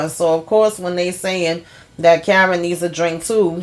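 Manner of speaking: usual speaking voice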